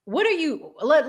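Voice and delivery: normal talking voice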